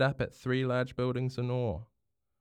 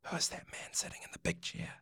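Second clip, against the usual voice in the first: much softer hushed whisper; 9 semitones higher, 10 dB quieter than usual. Acted. Hine asking in the public gallery, whispered. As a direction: whisper so nobody hears.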